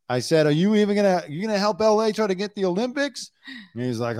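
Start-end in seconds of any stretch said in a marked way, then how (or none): 0.5-3.3 eager interviewer. Retold or imitated. Interviewer pressing Harvey about the Olympics, high and eager. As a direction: pitch the question up like a keen TV reporter.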